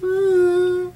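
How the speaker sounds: high, whimpering dumb stammer; clueless new Serena. Retold or imitated. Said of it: "she's just like".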